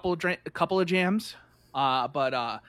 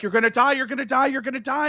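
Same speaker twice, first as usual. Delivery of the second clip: higher, rising mock-panicked chant; hammed-up alarm climbing higher; 8 semitones higher, 5 dB louder, faster than usual. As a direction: play an alarmed inner voice, repeating insistently. Voicing the panicked brain on a ride.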